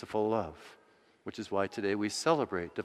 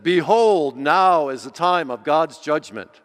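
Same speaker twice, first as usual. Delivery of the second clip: booming, much louder and higher pronouncement; stern proclamation; 5 semitones higher, 14 dB louder than usual. Pretend. Imagined Jesus declaring judgment to the disciples, booming stern. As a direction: thunder it out like a judge. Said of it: "looked at them very sternly and said".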